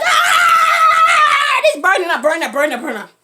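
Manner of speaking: loud, high mock yell fading; villain on fire, loud mock scream dying down; hammed-up burning yell. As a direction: yell and fade as he burns. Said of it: "he's like".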